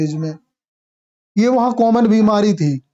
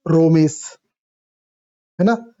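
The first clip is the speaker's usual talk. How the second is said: रोमेश with a rounded Bengali o sound; Bengali accent; 2 semitones lower than usual. Shown as a demonstration. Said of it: "ऐसे पढ़ते हैं Bengali accent में".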